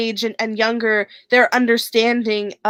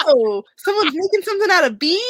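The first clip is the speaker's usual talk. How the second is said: surprised; 7 semitones higher, faster than usual. Retold or imitated. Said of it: "I was like".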